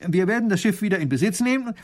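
fast, heated protest; indignant baron. Acted. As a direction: rush it out, outraged at the insolence. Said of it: "Baron von Raffigny ereifert sich".

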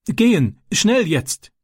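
high, urgent frightened reply; trembling fear. Acted. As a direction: blurt it out in panic. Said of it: "antwortete er zitternd".

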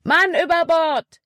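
high-pitched alarm cry; urgent call. A narrator's reading. Caleb alerts the crew to a man overboard, urgent shout. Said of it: "rief Caleb".

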